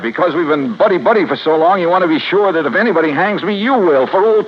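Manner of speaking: angry outburst. Acted. Accused detective lashing out at his old police friend, angry. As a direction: throw his friendship back in his face. Bitter quarrel with the sergeant.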